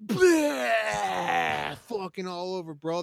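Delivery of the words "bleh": loud, high mock vomiting noise; hammed-up gagging sound; retching bleh. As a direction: fake a retch right at them. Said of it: "I said".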